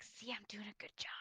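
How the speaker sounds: unvoiced whisper to a buddy, proud; whispered aside. Clerk whispering to his partner about the sale. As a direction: whisper it so the customer can't hear.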